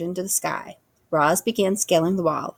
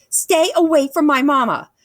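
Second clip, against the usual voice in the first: high, loud protective shout; 10 semitones higher, 6 dB louder than usual. A narrator's reading. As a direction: yell it to protect mama. Gosling defends mother.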